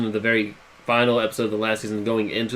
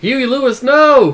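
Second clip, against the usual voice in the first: high shout, getting louder; 10 semitones higher, 6 dB louder, much slower than usual. Acted. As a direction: call out in protest. Shouts at leaving friend.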